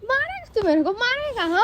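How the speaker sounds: shrill disbelieving confrontation; high indignant challenge. Acted. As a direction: throw the challenge back at him, voice jumping high. Confronting friend who threatened her.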